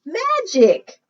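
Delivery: high, loud, wide-eyed "Magic!"; excited reveal. Acted. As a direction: burst out with a big magical announcement. Reveal moment for children.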